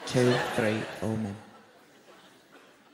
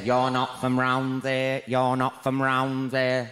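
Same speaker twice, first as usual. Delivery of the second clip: put-on slow, droning Manchester accent on one note; mock a neighbour's dragged-out Manc vowels; slower than usual. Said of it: "she's got that horrible Manc accent".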